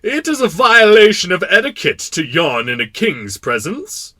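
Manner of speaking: loud, high royal indignation; rebuking king. Acted. King to the prince who yawned, indignant. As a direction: scold like an affronted monarch.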